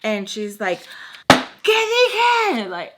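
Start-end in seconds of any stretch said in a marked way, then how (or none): 1.3-2.7 very high, loud Spanish demand; mock angry shout. Host catching guest taking blanket upstairs, mock shout. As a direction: imitate a screeching host, pitched way up.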